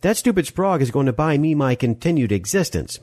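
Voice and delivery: high-pitched irritated retort; cross woman's comeback; snapping. Acted. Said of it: "Molly snapped".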